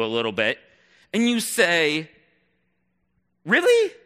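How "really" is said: a stunned, almost yelped Really; incredulous really. Acted. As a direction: shoot up high and loud in disbelief. Stunned reply to owners' excuse.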